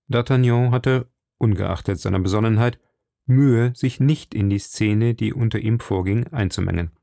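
ordinary delivery, nothing marked

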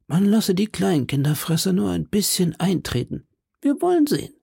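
high, measured voice from behind a door; calm call. A narrator's reading. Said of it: "rief hinter der Tür Athos mit ruhiger Stimme".

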